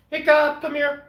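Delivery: high, loud call to the dog. Real, not acted. Owner calls dog Hiccup to the camera, high loud call. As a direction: sing out the dog's name to call him over.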